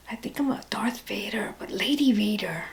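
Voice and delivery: soft unvoiced whisper. Real, not acted. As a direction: whisper the joke back. Whispered joke reply.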